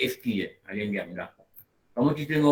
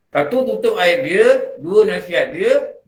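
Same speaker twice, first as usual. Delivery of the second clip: slow, louder, each point hammered out; emphatic listing; 5 semitones higher, 7 dB louder, slower than usual. Shown as a demonstration. Teacher drilling the points to the class, slow and emphatic. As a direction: count the points off slowly and firmly. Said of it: "teacher listing points emphatically".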